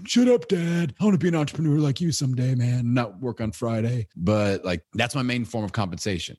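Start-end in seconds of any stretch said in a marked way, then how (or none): 0.0-4.1 put-on high, loud whiny kid voice; son's voice. Son snapping back at his teasing dad, whiny and high. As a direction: play the annoyed fourth-grader. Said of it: "He's like".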